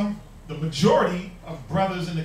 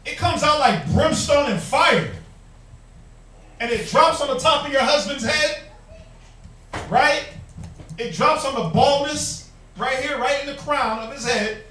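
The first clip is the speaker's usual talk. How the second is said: fiery preaching; 4 semitones higher, 4 dB louder than usual. Acted. Preacher rebukes harsh wives.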